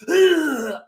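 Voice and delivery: loud, high retching noise; imitated dry heave. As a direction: fake a big dry heave. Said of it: "doing the dry heave".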